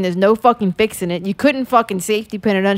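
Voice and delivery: ordinary talk, nothing marked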